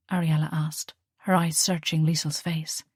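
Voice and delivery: plain reading voice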